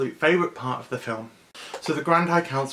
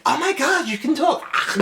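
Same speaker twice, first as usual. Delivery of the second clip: high squeal of amazed delight; excited little girl; 5 dB louder than usual. Retold or imitated. A girl amazed the alien can talk, high and excited. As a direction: shriek it like a stunned kid. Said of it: "she's just like".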